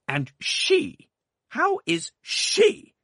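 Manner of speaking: loud, high, very slow sharp demand. A narrator's reading. Aunt sharply demanding news of the baby, loud. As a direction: bite each word out. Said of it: "said my aunt sharply".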